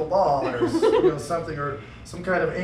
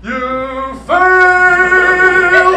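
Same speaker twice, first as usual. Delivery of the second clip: loud sung-out "You fail!" higher and louder; inner voice; 9 semitones higher, 12 dB louder than usual. Acted. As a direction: belt it out like a game-show buzzer. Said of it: "the guy in the back of your head that sometimes goes".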